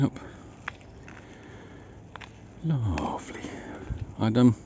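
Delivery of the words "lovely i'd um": whispering. Real, not acted. Cold dawn, hushed start.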